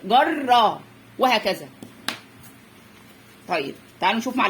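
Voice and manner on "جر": جر with rolled doubled ر, then open vowel; teacher stretching the ر in جر; doubled-letter demo. Shown as a demonstration. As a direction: sound out جر in two syllables. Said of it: "هناخد واحدة من دي عشان في شدة".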